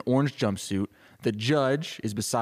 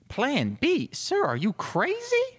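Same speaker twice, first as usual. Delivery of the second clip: very high, incredulous squeal; shrieky, disbelieving assistant voice; shocked assistant; slower than usual. Acted. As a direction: question the boss in alarm. Assistant shocked by chief's plan.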